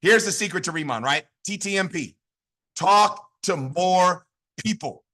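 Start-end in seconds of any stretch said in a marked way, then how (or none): 2.7-5.0 very slow, spaced-out words; deliberate word by word. Shown as a demonstration. Coach spelling out the secret behind T T M P, slow and deliberate. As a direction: lay out each word separately, slowly.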